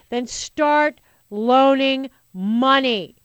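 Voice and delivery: slow, loud, stress on loaning; exasperated demand. Real, not acted. Host telling profitable banks to start loaning, slow and loud.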